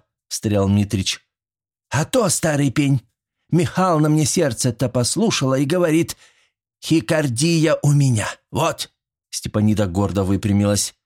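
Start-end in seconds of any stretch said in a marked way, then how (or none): 1.9-8.9 higher-pitched granny character, scoring a point; feisty old woman, proud. A narrator's reading. Old woman proudly reports her diagnosis to the men, triumphant. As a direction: announce it triumphantly as a cranky granny.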